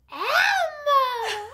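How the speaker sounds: very high, squeaky puppet voice; Elmo voice. Pretend. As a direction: do Elmo on request, high and loud. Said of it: "can you give us a little Elmo right now".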